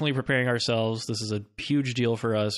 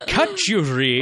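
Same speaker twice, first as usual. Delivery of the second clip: put-on Scottish accent; 5 semitones higher, 5 dB louder, slower than usual. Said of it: "Scottish constable".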